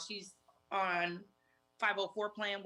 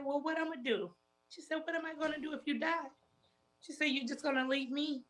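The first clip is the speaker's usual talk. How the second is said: pleading; 8 semitones higher than usual. Retold or imitated. Sister pleading in retold call.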